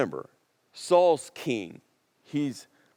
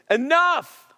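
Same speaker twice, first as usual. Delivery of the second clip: angry shout; 9 semitones higher, 5 dB louder than usual. Retold or imitated. Samuel's righteous anger.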